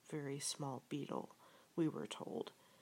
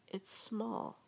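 higher-pitched exclamation; cried out; 6 semitones higher than usual. A narrator's reading. Piglet spotting Small on Pooh, cried out. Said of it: "cried Piglet".

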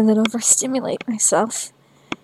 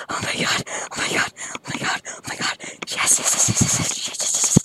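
fast unvoiced gasping whisper; breathless whisper; faster than usual. Real, not acted. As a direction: pant the words in a whisper. Whispered rapid climax.

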